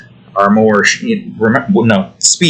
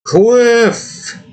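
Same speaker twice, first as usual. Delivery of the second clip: playful, drawn-out high exclamation; high-pitched call; 13 semitones higher than usual. Pretend. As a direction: sing out the word way up high. Playfully calling out the word cliff.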